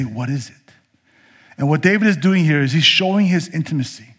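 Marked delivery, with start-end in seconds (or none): 0.0-0.7 soft, low, tender father; loving father answering a heartbroken child, softly; gentle father. Retold or imitated. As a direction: softly ask like a loving dad. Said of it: "A loving father ... would gladly say".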